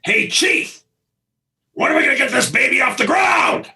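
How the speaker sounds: high, rough shouted call across the set; imitating George's gruff shout. Director recalls George calling out from his chair, gruff shout. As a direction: bark it out like an impatient star. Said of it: "George does this. He goes".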